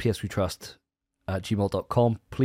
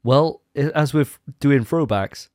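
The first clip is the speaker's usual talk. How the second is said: ordinary talk, nothing marked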